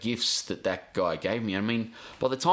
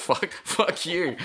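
slow and higher, laughing through the words; mock dad retort; 9 semitones higher, 3 dB louder, slower than usual. Son imitating his dad's lip-tattoo retort, laughing. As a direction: play dad's cheeky comeback, slow and amused. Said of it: "just show his bottom lip to us".

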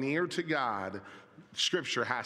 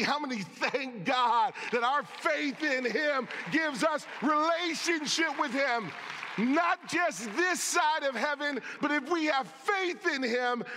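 preaching at full force; 9 semitones higher than usual. Acted. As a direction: build the congregation to a shouting climax. Preaching builds to climax.

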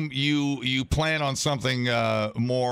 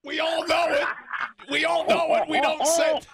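mock scolding shout. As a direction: yell at him to stop saying it. Scolding Dave on air.

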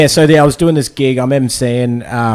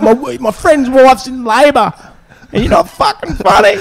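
imitating angry heckler; 11 semitones higher than usual. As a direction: drag it out like an outraged drunk. Heckler abusing comedian.

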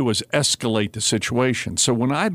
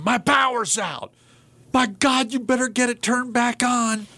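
much higher, whiny mock complaint; angry customer; 11 semitones higher than usual. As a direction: imitate a frantic caller. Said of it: "I used to work the twenty-four hour storm".

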